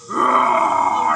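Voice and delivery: low mock roar; a man faking a lion roar. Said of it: "I hear from my left".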